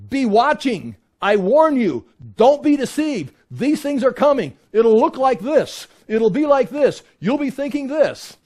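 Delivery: urgent pulpit warning; emphatic warning. Retold or imitated. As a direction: drive each warning home, high and loud. Retells the Lord's emphatic warnings.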